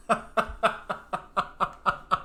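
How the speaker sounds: breathy real laugh; cracking up. Real, not acted. Laughing at own joke.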